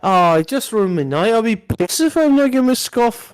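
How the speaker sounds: high, loud Scouse impression; Scouse accent. Retelling Paddy's rant in a loud high Scouse voice. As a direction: belt it out like a Scouser. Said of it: "the old Scouse accent".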